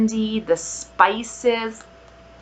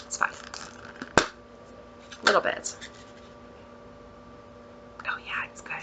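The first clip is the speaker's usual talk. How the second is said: whisper; 14 dB quieter than usual. Real, not acted. Sniffing the wax, whispering her verdict. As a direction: whisper it, savoring the smell.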